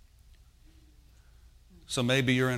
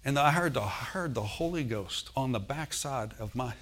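usual speaking voice

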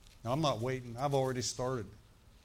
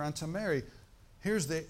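usual speaking voice

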